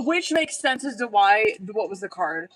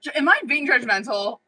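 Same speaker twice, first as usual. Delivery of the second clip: usual speaking voice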